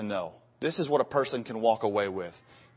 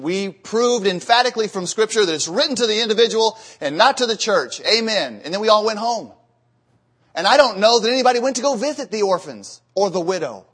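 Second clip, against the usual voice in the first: high, loud pulpit fervor; rising loud sermon voice; fervent preaching; 5 semitones higher, 4 dB louder than usual. Acted. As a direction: preach it out hard and high to the congregation. Preacher rises to full force.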